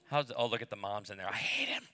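ordinary talk

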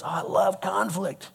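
soft, slow mock delight; hammed-up dreamy fondness; mock enthusiasm. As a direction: pretend to adore it, drawn out. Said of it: "I don't think anybody goes".

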